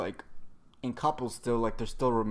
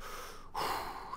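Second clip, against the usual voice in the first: hard audible exhale; imitated heavy breath; 9 dB quieter than usual. Both exhausted fighters agreeing, imitated heavy breathing. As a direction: blow out one long winded breath. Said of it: "they're breathing hard".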